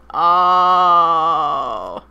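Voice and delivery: long, loud "oh" of dismay; booming, held-out groan; loud drawn-out groan. Real, not acted. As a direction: let out one big sustained groan. Long loud groan interrupting the reading.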